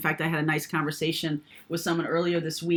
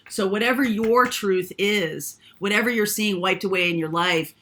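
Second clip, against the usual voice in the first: ordinary talk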